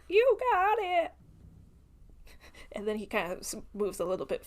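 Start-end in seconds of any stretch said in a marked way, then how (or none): 0.1-1.1 cheery call. Acted. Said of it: "He's like".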